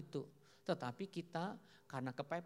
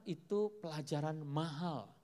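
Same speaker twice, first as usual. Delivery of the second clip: usual speaking voice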